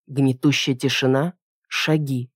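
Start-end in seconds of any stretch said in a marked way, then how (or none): none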